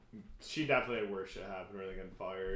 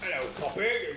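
pirate voice; 6 semitones higher, 6 dB louder than usual. Retold or imitated. Pirate movie character voice.

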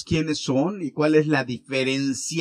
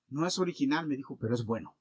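1 confiding softly; 4 semitones lower, 7 dB quieter, faster than usual. Retold or imitated. Pastor confides fake watch quietly.

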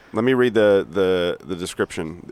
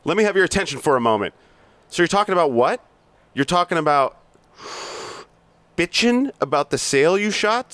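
hard-driving sales boss berating staff; bully the salesmen with contempt; reading Blake's tirade, pushy and mocking; aggressive sales boss; 10 semitones higher, 3 dB louder than usual. Plays Blake monologue.

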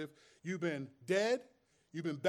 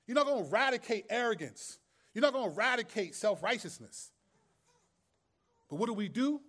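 ordinary talk, nothing marked

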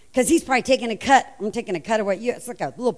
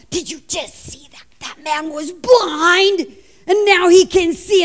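amazed crowd buzz, rising higher; electrified; 6 semitones higher, 4 dB louder than usual. Acted. Electrified crowd telling of the blind man healed, astonished. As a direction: marvel at it, building to a loud peak. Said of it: "This is the electrified crowd".

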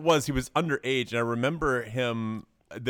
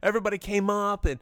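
higher, eager retelling as the winner; young athlete's interview; 6 semitones higher than usual. Retold or imitated. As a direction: imitate an excited teen champion being interviewed. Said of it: "he was like".

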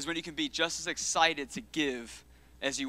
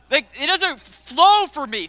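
playful whine; 9 semitones higher, 10 dB louder, slower than usual. Real, not acted. Playful whiny complaint about words.

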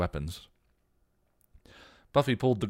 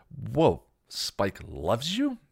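slow, stunned blurted question; blurting; 6 semitones higher, 4 dB louder, much slower than usual. A narrator's reading. Xander blurts at Buffy in disbelief. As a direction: let it slip out in shock. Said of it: "Xander blurted".

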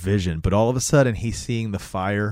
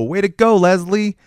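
raised, loud mock cheer; distant call-out; 9 semitones higher, 7 dB louder than usual. Somebody in the distance congratulating Leslie, calling out. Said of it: "somebody in the distance go".

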